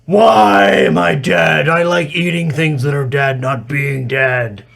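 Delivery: high, loud, slow troll bellow; dopey cartoon bellow. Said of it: "The troll says".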